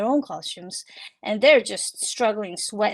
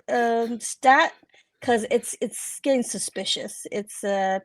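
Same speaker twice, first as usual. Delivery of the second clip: usual speaking voice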